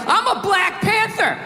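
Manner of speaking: hammed-up activist; mock militant. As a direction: ham up a protest chant. Mock radical slogan.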